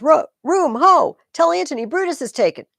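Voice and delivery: high, loud call to the camp. Acted. Soldier to his comrades, a loud urgent call. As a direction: shout for room and for Antony.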